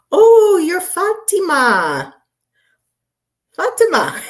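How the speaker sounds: very high, loud, drawn-out squeal; gleeful exclamation. Real, not acted. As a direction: squeal it slowly, sliding down at the end. Excitedly teasing someone in chat.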